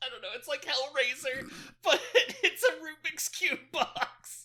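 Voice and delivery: high-pitched, helpless laughing through words. Real, not acted. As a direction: crack up while getting the comparison out. Cracking up at the joke.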